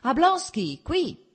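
shouting down the platform to the brother. Acted. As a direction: call out loud and high to Oblonsky. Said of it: "e gridò".

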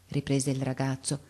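usual speaking voice

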